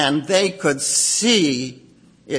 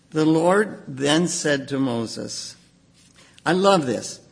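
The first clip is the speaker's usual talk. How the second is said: usual speaking voice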